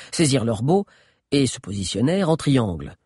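ordinary delivery, nothing marked

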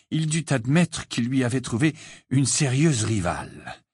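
usual speaking voice

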